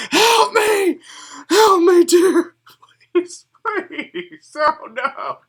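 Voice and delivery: high, loud panicked cries for help; desperate screaming. Acted. Muddy elf screaming for help at the party's camp, high panicked yells. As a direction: shriek for help like a drowning man. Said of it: "he is screaming at the top of his lungs".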